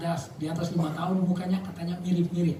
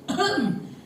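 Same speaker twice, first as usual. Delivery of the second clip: short throat-clearing as a signal; a put-on little cough; demonstrative cough; 12 semitones higher than usual. Shown as a demonstration. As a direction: clear your throat pointedly. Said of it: "kalau saya batuk".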